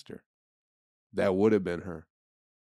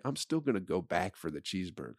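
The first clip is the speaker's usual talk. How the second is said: normal talking voice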